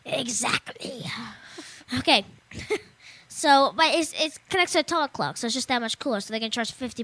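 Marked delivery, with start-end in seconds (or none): 0.0-1.0 breathy whispered agreement. Real, not acted. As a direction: whisper it as an aside. Whispered agreement after repeat.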